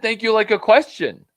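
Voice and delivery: "Thank you" with a high, loud questioning lift. Retold or imitated. Secondary friend thanking, "Thank you" as a loud high question. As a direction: make "Thank you" sound unsure, like asking.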